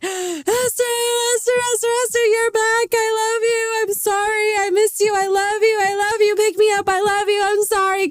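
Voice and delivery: hammed-up wailing tot, very high and loud; high-pitched, loud mock blubbering in one breathless run; crying little baby. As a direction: imitate a tiny child bawling for mommy at daycare. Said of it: "Crying, crying. I was crying".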